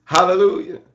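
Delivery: ordinary delivery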